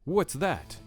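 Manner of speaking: usual speaking voice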